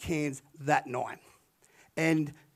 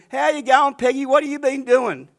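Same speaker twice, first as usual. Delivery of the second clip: loud greeting; 8 semitones higher, 9 dB louder than usual. Retold or imitated. Table greets Peggy loudly.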